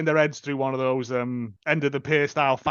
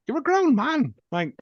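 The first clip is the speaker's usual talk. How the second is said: exasperated; 8 semitones higher than usual. Acted. Venting at a film character.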